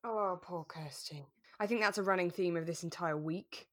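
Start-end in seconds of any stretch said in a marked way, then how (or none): none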